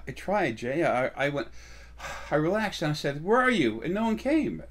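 mock complaint, pitched high and loud; complaining follower. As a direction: imitate someone protesting that they really tried. Imitates a complaining follower's excuse.

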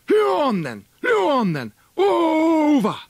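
high, loud cry urging the hounds; ringing hunting holler; huntsman's call. Acted. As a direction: shout the hounds on across the water.

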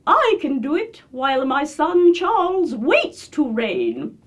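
high, loud, slow queenly voice; regal queen. Shown as a demonstration. Queen speaks of son Charles waiting to reign, regal. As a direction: proclaim it as a high-voiced monarch, drawn out.

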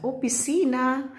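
loud joking shout; joking exclamation. Real, not acted. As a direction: blurt it out as a joke. Jokes office is a house.

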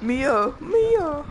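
high, loud call for Mia. Real, not acted. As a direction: shout her name up high. Calling out to character.

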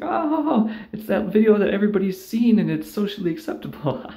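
gushing coworker. Retold or imitated. Coworkers approving a popular Facebook video, high and gushing. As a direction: coo over the viral video. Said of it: "So everyone's like".

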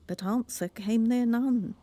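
ordinary delivery

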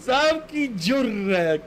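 high, very drawn-out silencing; exaggerated shut-up. Real, not acted. Player silencing a mouthy teammate, exaggerated and drawn out.

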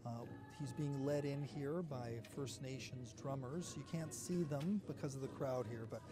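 low, soft live commentary; hushed commentary. Real, not acted. Reporter explains the crowded ceremony to viewers, hushed and low. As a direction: keep your voice well down, under the event.

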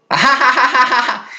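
high staccato "ha ha" cackle; mock Russian laugh. Mom hearing her recorded words, high mock Russian laugh. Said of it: "you know, the Russian laugh".

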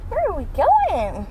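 high, sing-song question; excited pet talk. Real, not acted. Asking the puppy about the car ride, high and excited.

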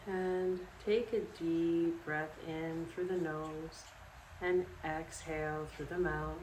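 calm, unhurried guided relaxation with long pauses; guided meditation. Acted. Yoga instructor guiding listeners to sleep in bed, calm meditation voice. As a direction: lead them through it step by step, leaving space after each instruction.

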